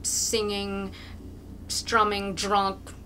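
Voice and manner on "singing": ordinary delivery, nothing marked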